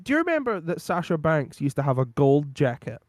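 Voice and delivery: normal talking voice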